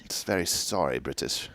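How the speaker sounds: put-on soft, unconvincing British accent; badly faked British accent. Host jokes back at co-host, in badly faked British accent. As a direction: try a British accent and miss. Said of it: "definitely doesn't sound like it".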